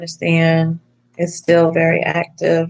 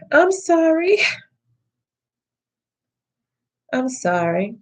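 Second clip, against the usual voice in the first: tearful apology; 6 semitones higher than usual. Real, not acted. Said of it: "I cry a lot".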